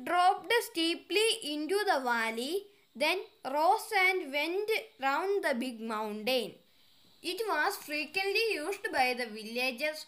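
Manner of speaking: slow, deliberate read-aloud of an English exercise; careful dictation pace; slow textbook reading. Acted. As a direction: read each word out clearly for the class. Reads passage softly and slowly.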